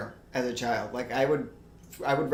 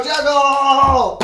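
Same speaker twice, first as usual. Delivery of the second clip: shout; 10 semitones higher, 9 dB louder than usual. Real, not acted. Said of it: "announces juggling".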